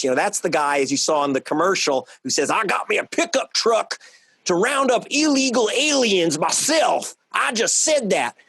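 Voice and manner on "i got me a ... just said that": mock Dogpatch accent. Mocking the governor's phony ad in a put-on Dogpatch Southern accent. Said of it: "talk like it's from Dogpatch to fool the goobers".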